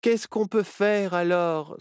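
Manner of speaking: high, falling wail of helplessness; distraught fox character's lament. A narrator's reading. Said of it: "se lamenta Nao".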